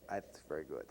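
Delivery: a hint of Spiegel's dry German-accented praise; imitating Spiegel. Recalling his mentor's dry praise, lightly accented. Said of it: "He says".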